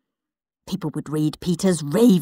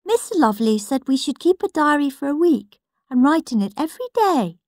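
put-on prissy little boy; 7 semitones higher than usual. Peter prim.

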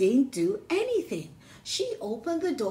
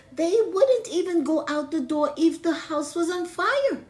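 mock the dumb pigs, pitched up; higher mocking; 7 semitones higher, 4 dB louder than usual. Megan sneering at the pigs, higher and louder. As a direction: taunt them with scorn, high and loud.

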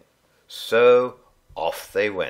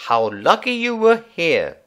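grateful mum; 8 semitones higher, 6 dB louder than usual. A narrator's reading. Mum thanking Alfie.

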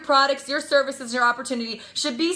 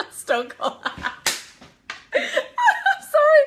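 breaking up with laughter; real laughing; 13 semitones higher, much slower than usual. Real, not acted. Speaker apologizes after own accent joke, laughing hard. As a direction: try to talk through a laughing fit.

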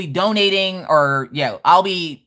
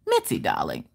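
Mitzi voice; 12 semitones lower, 7 dB quieter than usual. Acted. Greeting chatter Mitzi in her made-up voice, low and grand. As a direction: purr it like a grand lady. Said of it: "how Mitzi got this voice".

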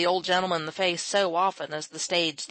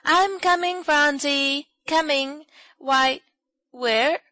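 high, loud, cheerful calling; bright-hearted call from down the path; 9 semitones higher, 7 dB louder, slower than usual. A narrator's reading. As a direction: sing it out gaily as you come. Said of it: "She sang gaily on the way".

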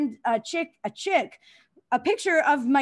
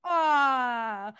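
ordinary talk

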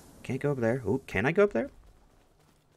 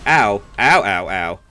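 loud mock ow; 15 dB louder than usual. Taking hits in game.

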